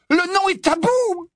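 high, loud and slow bellow. Acted. Ron jumping up to warn Harry, loud high bellow. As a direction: roar the warning as you jump up. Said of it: "beugla Ron".

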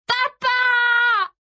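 long, very high, loud scream; drawn-out desperate high yell. A narrator's reading. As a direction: scream it out to be heard over waves. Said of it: "hurla Kirsty".

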